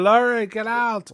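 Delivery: hammed-up urgent shout at his wife; mock yell. Retold shootout, yelling at wife.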